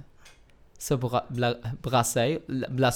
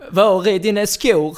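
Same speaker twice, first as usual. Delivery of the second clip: mock Scanian; 6 semitones higher, 10 dB louder than usual. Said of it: "så han säger".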